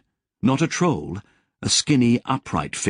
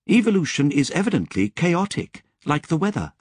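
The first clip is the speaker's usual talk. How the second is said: higher, measured android voice; a lightly higher, precise Lobsang; Lobsang's voice; 6 semitones higher than usual. A narrator's reading. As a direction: voice the calm, knowing AI.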